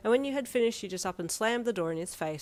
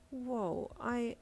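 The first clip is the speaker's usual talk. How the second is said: surprised; 4 semitones higher, 5 dB quieter than usual. A narrator's reading. Startled by heat pad.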